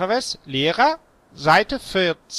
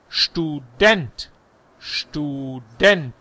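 "Student" stressed on DENT, starting with sch; German stress; much slower than usual. Shown as a demonstration. Teacher corrects English stress, German end-stressed "Student". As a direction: draw out "Student", landing on the second syllable. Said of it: "die Betonung nicht Englisch, sondern Deutsch".

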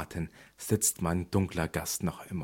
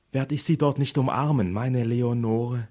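desperate plea; 4 semitones higher, 5 dB louder than usual. Acted. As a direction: beg for an answer about Leonore. Pleading for lost Leonore.

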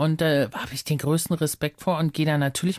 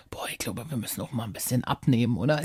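imitating mother; 6 semitones lower, 5 dB quieter than usual. Mother voiced at dinner.

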